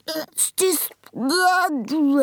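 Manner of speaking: slow, high gurgle through food; gurgling. A narrator's reading. Giant with a mouth full of snozzcumber, gurgling. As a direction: gurgle it out in disgust. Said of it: "the B F G gurgled".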